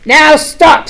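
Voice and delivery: king Max halting the monsters; Max commanding. Acted. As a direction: stop the party with a sharp order. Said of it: "said Max".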